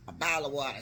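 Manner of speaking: ordinary delivery, nothing marked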